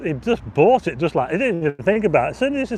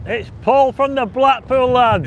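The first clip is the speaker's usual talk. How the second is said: higher, louder and slow, presenting a surprise guest; proudly introducing Paul to the live viewers; excited announcement; 7 semitones higher, 4 dB louder, slower than usual. Real, not acted. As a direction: unveil the guest with a showman's flourish.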